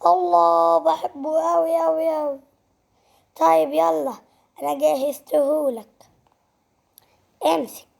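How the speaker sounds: ordinary talk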